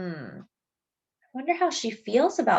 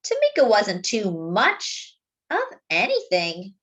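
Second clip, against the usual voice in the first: put-on gentle read-aloud for children; story narration; 5 semitones higher, slower than usual. A narrator's reading. Narrator reading a story to young listeners, gently. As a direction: tell it like a bedtime picture book. Reads the picture book aloud.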